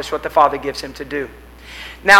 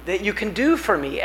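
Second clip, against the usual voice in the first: soft plea; 8 dB quieter than usual. Retold or imitated. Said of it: "we cry out".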